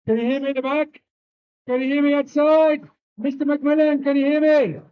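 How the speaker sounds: loud call across the hall; calling from far away. Real, not acted. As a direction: shout out to the back rows. Shouting to the crowd without mic.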